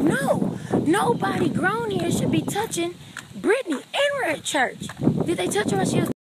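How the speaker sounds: loud, high-pitched outraged rant; acted-out righteous alarm, refusing to calm down; worked up. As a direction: rant at full voice, threatening to tell. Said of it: "they trying to calm me down".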